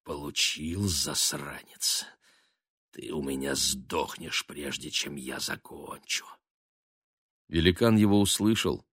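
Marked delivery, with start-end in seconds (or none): 0.0-6.2 low, soft, gloating hiss in the ear; gloating whisper. A narrator's reading. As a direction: whisper it with cruel glee. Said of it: "злорадно шепнул гиганту в ухо".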